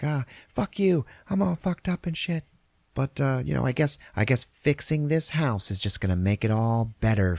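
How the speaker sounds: high-pitched imitation of a drunk, defiant wife; drunk wife yelling. Drunk wife lashing out at husband, high mock yelling. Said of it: "She starts fucking yelling at everybody".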